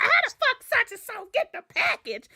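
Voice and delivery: auntie's crying shout. Retold or imitated. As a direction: holler it in outraged disbelief. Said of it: "She's like".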